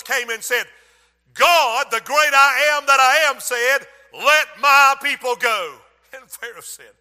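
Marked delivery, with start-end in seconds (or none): 1.3-5.8 loud, slow call to let the people go; commanding proclamation. Acted. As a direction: boom it out like a prophet. Moses speaks for God.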